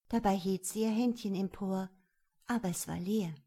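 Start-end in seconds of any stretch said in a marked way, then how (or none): none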